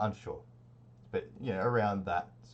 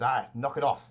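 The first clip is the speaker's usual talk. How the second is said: louder, irritated hooded cultist; annoyed cultist; 5 semitones higher, 8 dB louder than usual. Acted. Hidden cultist scolds his unseen companion, irritated and louder. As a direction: snap it off at someone unseen.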